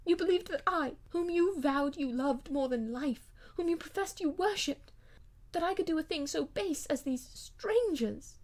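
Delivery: wife desperately defends herself to her cold husband; impassioned wife. Acted. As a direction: plead her case with rising passion.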